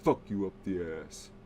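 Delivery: low, soft deadpan threat; a hint of drunk menace, low and soft. Shown as a demonstration. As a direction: say it dead-eyed and quiet. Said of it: "He said it like this".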